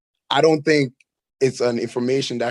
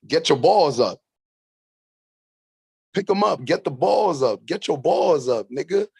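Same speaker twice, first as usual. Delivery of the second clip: higher, sing-song goading voice; cartoon old guy; 4 semitones higher than usual. Quoting the show's old guy to the boys and grandpa, mock goading. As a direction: imitate a cartoon old man egging them on. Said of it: "he's the biggest dickhead in the show".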